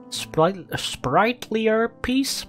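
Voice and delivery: "sprightlier" said slowly, high and drawn out; sprightlier said carefully. Shown as a demonstration. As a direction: stretch out "sprightlier piece" slowly, rising.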